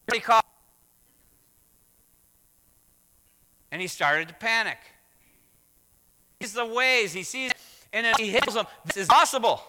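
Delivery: loud, high-pitched emphatic preaching; preaching at full force. Acted. Pastor proclaiming to the congregation that Peter walked on water, full-force preaching. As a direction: drive the point home to the congregation.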